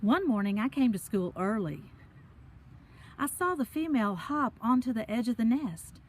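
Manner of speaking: ordinary delivery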